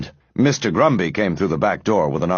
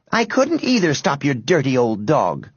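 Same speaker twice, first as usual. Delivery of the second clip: put-on higher-pitched indignant girl; defensive retort; 6 semitones higher than usual. A narrator's reading. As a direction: retort primly to the boys.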